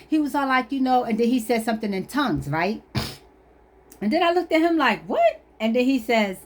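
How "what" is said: high squeaked disbelief; incredulous high-pitched question; acted bafflement. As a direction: react totally thrown, pitch shooting up. Baffled look after tongues.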